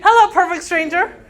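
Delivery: mock overfriendly greeter; cheery greeting. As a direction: call out to a stranger with glee. Sanguine greets strangers in street.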